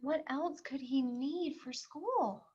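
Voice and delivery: ordinary talk, nothing marked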